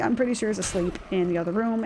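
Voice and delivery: normal talking voice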